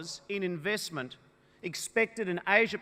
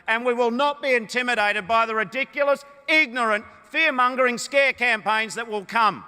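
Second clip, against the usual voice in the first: builds from firm to full-throated shouting, louder and higher; rising rally shout; 4 semitones higher, 5 dB louder than usual. Real, not acted. Leader defying opponents' scare campaigns, rousing rally shout. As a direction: roar it over the cheering crowd.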